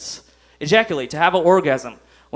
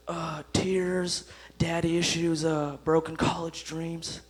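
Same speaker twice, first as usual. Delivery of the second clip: hushed order; 6 dB quieter, slower than usual. Acted. Customer orders in creepy hush.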